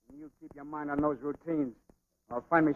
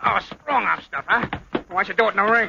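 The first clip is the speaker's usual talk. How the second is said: loud, raised, goading jeer; heated taunt; 5 semitones higher, 7 dB louder than usual. Acted. Rival daring a boxer to fight, loud heated taunt. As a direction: goad him into a fight, loudly.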